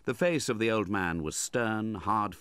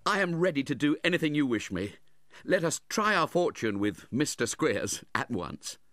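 higher, louder, eager voice; 8 semitones higher, 4 dB louder than usual. A narrator's reading. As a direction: agree eagerly and cheerfully. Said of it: "starting gaily up".

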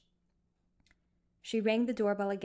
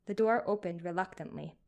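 ordinary delivery

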